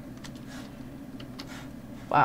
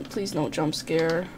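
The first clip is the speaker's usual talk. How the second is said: normal talking voice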